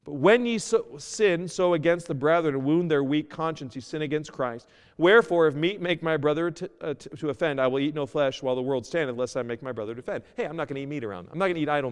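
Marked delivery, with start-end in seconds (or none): 0.0-10.2 preaching the verse; scripture reading. Acted. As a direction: read the passage with weight. Reads scripture aloud, preaching.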